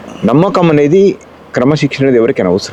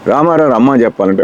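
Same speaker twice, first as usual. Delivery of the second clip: ordinary delivery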